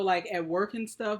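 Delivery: ordinary delivery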